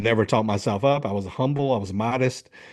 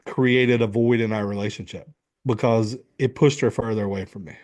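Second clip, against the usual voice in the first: ordinary delivery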